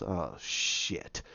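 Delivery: very low, hushed mock dismay; quiet, sinking realization; dismayed curse. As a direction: let it sink out under your breath. Character's dismayed curse, retold.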